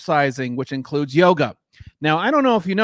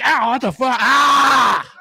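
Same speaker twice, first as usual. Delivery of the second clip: pained yelp; 6 semitones higher, slower than usual. Retold or imitated. Stabbed by his own beard in bed, mock yelp of pain. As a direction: cry out as if stabbed in your sleep. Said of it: "I'd be like".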